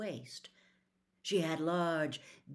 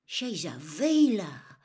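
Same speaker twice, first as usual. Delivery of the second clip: hoarse; 8 dB louder, slower than usual. Acted. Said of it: "he said hoarsely".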